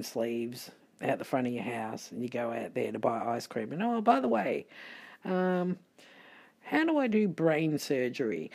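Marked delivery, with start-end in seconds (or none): 3.8-8.5 a light, higher mock-casual question; mock naive customer. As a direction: play a clueless buyer, lightly. Mock asking the ice cream man.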